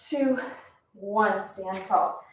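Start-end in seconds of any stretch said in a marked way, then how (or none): none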